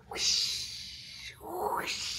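skating sound effect; whooshing sound. Acted. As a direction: breathe out like skates gliding by. Imitated roller skating sound.

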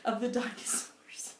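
breathy spooky whisper; whispered aside. Real, not acted. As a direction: hush it like a secret. Whispered aside about dinosaurs.

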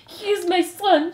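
high, loud, drawn-out plea; melodramatic mother. A narrator's reading. Antoinette claiming her condemned son, melodramatic. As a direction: declare it high and slow, theatrically distraught.

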